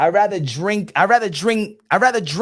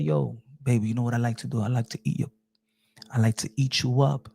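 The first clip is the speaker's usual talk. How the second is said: low, smooth come-on; sweet-talking man; 10 semitones lower, 3 dB quieter than usual. Pretend. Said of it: "Maybe he said".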